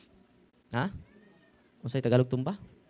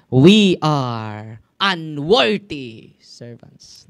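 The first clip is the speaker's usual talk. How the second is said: "We are unworthy servants" boomed out slowly and loudly; loud slow scripture; 14 dB louder, much slower than usual. Shown as a demonstration. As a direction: thunder the verse out word by word. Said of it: "Luke chapter seventeen, verse ten". (binibigkas nang malakas at napakabagal ang "We are unworthy servants")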